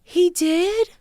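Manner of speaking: high, shocked question; surprised. Real, not acted. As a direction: jump up in surprise. Hears man died.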